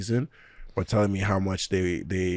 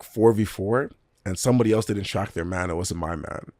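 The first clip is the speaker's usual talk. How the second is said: ordinary talk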